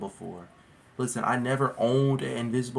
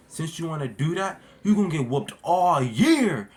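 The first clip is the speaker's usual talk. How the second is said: stern dad; 4 semitones higher, 6 dB louder than usual. Retold or imitated. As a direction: lay down the punishment like a stern dad. Dad threatening punishment.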